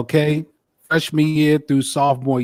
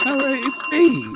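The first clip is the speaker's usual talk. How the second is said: high excited announcement; proud self-intro; 11 semitones higher than usual. Real, not acted. Announces himself.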